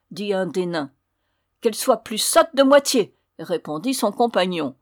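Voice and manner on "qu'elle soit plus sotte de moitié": high, loud pronouncement; cursing dwarf. A narrator's reading. Second dwarf cursing the rude girl, loud and spiteful. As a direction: cast the spell with relish.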